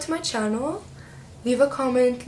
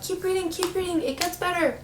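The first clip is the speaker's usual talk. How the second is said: higher, softer mock of eager fans; mock fan advice; 8 semitones higher, 3 dB quieter than usual. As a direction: parrot the followers' pleading advice. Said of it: "so many of y'all have told me".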